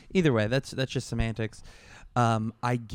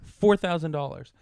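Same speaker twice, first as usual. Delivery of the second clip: usual speaking voice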